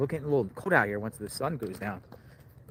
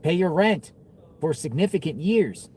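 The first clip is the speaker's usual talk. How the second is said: salesy pitch; 5 semitones higher, 5 dB louder than usual. Real, not acted. Pitching the reward to viewers.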